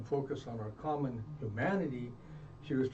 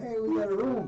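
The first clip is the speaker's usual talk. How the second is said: bright, triumphant call, pitched up high and louder; proud to have landed the room; excited announcement; 10 semitones higher, 6 dB louder than usual. Retold or imitated. Telling his friends outside he got the room, excited.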